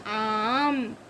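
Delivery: आम with a drawn-out aa before the m; long vowel. Shown as a demonstration. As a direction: stretch the aa in आम. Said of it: "क्या बोलेंगे?".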